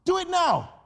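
high, loud repeated command; insistent command. Acted. Preacher voicing God urging the listener, high and insistent. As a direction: drive each do home. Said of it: "God is saying".

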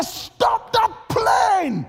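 very high, slow, loud scream. Retold or imitated. Preacher retelling yelling at airline crew to stop the plane, screaming. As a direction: scream it out long and desperate. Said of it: "I just screamed".

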